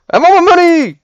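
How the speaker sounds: high screaming video game voice; Raiden screaming. Retold or imitated. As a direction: shriek it like a fighting game character. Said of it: "sounds like he's screaming for his money back".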